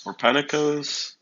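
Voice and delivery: "Or Panikos?" high and slow, rising at the end; attempted pronunciation. Shown as a demonstration. Streamer guessing again at a viewer's name, tentative questioning. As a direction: offer "Panikos" as an unsure second guess, without the second n. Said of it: "let me try to pronounce your name".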